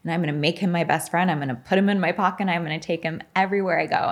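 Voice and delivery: fast, eager tumble of plans; gushing rush. Retold or imitated. Trainee planning to befriend teacher, gushing rush. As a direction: rattle it off with fond enthusiasm.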